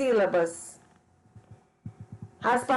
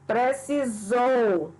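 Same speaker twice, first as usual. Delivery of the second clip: "Precisou" very slow, final SOU held; teacher's slow "Precisou", weight on SOU; stress on the tonic syllable; much slower than usual. Shown as a demonstration. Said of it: "Zou não é acentuado".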